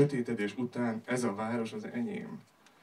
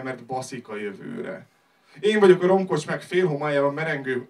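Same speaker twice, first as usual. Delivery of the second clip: usual speaking voice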